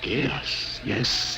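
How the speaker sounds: breathy hushed yes, yes; half-whispered confirmation. Acted. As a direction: drop to a secretive hush. Confirming a grim secret.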